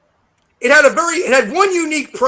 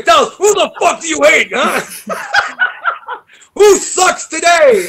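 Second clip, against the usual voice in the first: louder, higher, hammed-up teasing challenge; mock blunt question; 7 semitones higher, 6 dB louder than usual. Teasing the interviewer about who he hates, mock-tough. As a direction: throw the question back, mock-aggressive.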